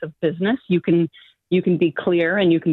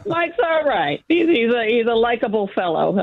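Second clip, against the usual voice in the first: amused grudging concession, giggling; laughing; 6 semitones higher than usual. Real, not acted.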